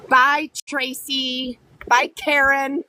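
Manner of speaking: slow, drawn-out, sing-song bye; mocking goodbye. Real, not acted. Dismissing a commenter.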